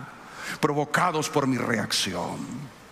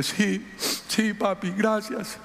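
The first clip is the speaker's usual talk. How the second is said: put-on higher, slow little girl thanking; daughter's thanks; 7 semitones higher, much slower than usual. Pastor voices young daughter thanking him, high and slow. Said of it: "Salomé dice".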